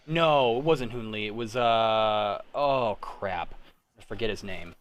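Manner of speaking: ordinary delivery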